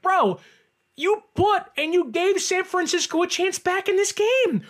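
worked-up fan scolding the receiver; exasperated rant. Retold or imitated. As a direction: scold him like you can't believe it. Ranting at laughing Reynolds.

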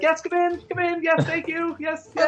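very high, tiny eager voice; a little hedgehog ushering guests in; hedgeling. Acted. Hedgeling welcoming customers in, very high and eager. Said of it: "the hedgeling's like".

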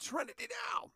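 high strained yell; Seinfeld scream. Retold or imitated. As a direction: scream for calm, frantic. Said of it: "Remember the Seinfeld?".

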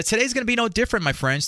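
ordinary talk, nothing marked

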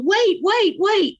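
high, loud frantic wait, wait; desperate calling out. Retold or imitated. Pleading with her mother in the dream, frantic and high. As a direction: cry out to stop her leaving.

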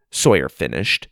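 plain reading voice

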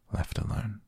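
ordinary delivery, nothing marked

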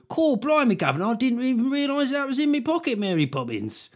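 high, hammy Cockney geezer; Cockney caught with the goods; Cockney accent. Retold or imitated. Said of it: "just me doing a Cockney accent".

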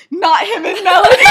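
high, loud wail breaking into laughter; laughing mock protest. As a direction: shout it like an appalled friend, half laughing. Retold protest by exasperated friends.

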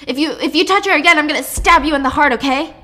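angry threat. Acted. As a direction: threaten a hostage in a rage. Attacker threatens to stab hostage.

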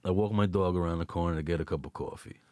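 low, quick Brooklyn delivery; Brooklyn accent. Shown as a demonstration. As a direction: rattle it off in a thick Brooklyn accent. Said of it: "Can you do a Brooklyn accent?".

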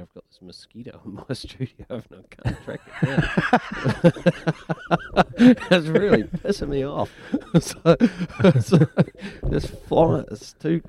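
talking through real laughter; laughing. Real, not acted. Host laughing through a studio mosquito complaint.

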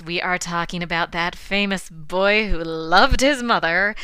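ordinary talk, nothing marked

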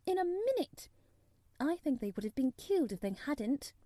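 put-on lifted girlish voice recounting a marvel; Mary, storytelling. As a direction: tell a wondrous tale as a young girl. Voices Mary telling of Rajah.

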